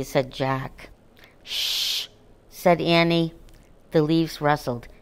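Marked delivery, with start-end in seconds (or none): 1.4-2.1 soft, breathy hush; quiet him so the puppy isn't scared. Acted. Annie hushing Jack in the dark woods, soft shh.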